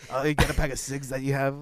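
hammed-up smoker bumming a cig; cigarette lady. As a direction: put on a chain-smoker's voice. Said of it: "no cigarette ladies".

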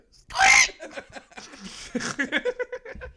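piercing high-pitched squeal; high squeak. Real, not acted. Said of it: "you squeak".

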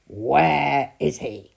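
loud, slow giant demand. A narrator's reading. Giant searching for Jack, loud and slow demand. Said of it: "demanded the giant".

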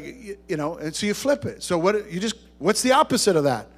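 ordinary delivery

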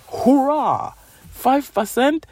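high, hammed-up cheer over a tiny win; mock cheer. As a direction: celebrate it like a big victory, tongue in cheek. Mocking her own tiny progress.

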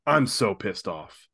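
slow, grumbling mock complaint; mock grumpy. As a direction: drag it out like a sulky friend. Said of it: "And then you're like".

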